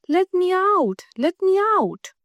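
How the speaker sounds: a caged bird pleading with the princess; pleading little bird. A narrator's reading. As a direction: beg to be let out in a high voice. Caged bird begs a third time.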